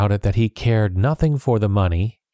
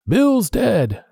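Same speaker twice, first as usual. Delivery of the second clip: much higher alarmed yell from inside; a pirate shouting his mate is dead; shocked shout; 11 semitones higher than usual. A narrator's reading. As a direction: cry out in alarm at the body. Pirate finds dead man inside.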